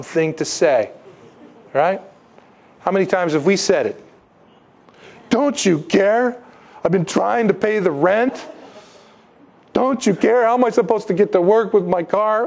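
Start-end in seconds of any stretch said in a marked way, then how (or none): 5.3-12.5 hammed-up whining, pitched up; whiny complaint. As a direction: mimic a self-pitying believer. Mocking our own complaints.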